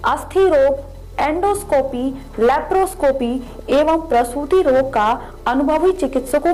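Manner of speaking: upbeat radio ad voice; ad read. Acted. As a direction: sell it like a local ad. (Radio विज्ञापन वाली आवाज़)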